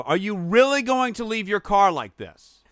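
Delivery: high, drawn-out incredulous call; indignant retold challenge. Driver calling out to a bad parker, indignant.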